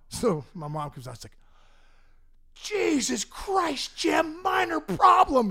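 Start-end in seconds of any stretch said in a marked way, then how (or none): 2.5-5.5 exasperated mom shout. Retold or imitated. Mom yelling at dad over the wrecked car, slow exasperated shout. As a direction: drag it out, furious.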